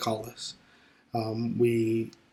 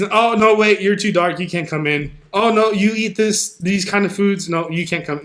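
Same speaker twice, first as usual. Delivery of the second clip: higher, louder mocking gatekeeper voice; 5 semitones higher, 5 dB louder than usual. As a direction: play the snooty doorman turning people away, loud at first.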